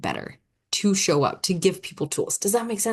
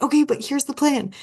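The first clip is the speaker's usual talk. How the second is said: eager planner; 9 semitones higher, 3 dB louder than usual. Retold or imitated. Switching into planner mode.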